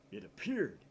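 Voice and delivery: sudden high, loud gasp of a line; startled exclaim. Acted. Seeing the banana reappear, a high startled outburst. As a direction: blurt it out in shock.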